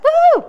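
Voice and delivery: a big, high joyful "woo"; loud high whoop. Real, not acted. Host cheering her listeners with a loud whoop. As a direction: let out a delighted cheer.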